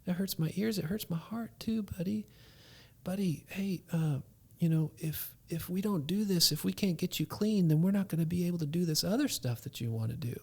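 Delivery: soft, hushed reassurance; father calmly talking his son down; gentle dad. Retold or imitated. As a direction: coax the child quietly. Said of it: "It's like".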